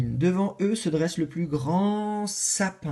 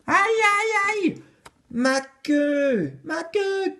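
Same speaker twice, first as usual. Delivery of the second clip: very high, loud squeal of pain; crying out in pain; 13 semitones higher, 4 dB louder, much slower than usual. Acted. La souris dont l'ours écrase la queue, cri aigu. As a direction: yelp as your tail gets crushed. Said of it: "Crie une voix".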